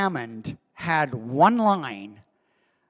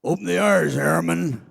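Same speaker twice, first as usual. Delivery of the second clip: Southern general's order; 3 dB louder than usual. Retold or imitated. General ordering the airman, lightly Southern drawled. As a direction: bark the order with airman slurred toward Harriman. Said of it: "Don, try that again".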